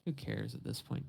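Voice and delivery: ordinary talk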